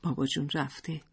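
softly, with a lump in the throat; understated tearful hush; choked up. A narrator's reading. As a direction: narrate a mother holding back tears. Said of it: "لیلا با بغض گفت".

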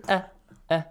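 "uh" as a short glottal catch; hamza sound. Shown as a demonstration. Teacher contrasting with ayn, "uh" as a light hamza. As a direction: cut "uh" short with no throat squeeze. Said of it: "Hamza is".

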